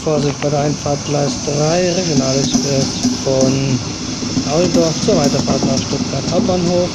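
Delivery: even tannoy delivery of train times; flat platform announcement. Acted. Model railway station announcements.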